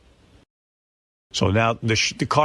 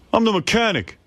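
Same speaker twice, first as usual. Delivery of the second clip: higher, curt know-it-all mechanic; mechanic with attitude; 9 semitones higher than usual. Retold or imitated. Rude mechanic answering the phone to his wife, curt. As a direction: brush her off like a rude mechanic. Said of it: "he's giving her an attitude".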